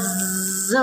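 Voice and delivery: ஜிஸ்ன்னு with a long buzzing zzz; bee buzz. Shown as a demonstration. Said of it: "தேனி எப்படி sounds".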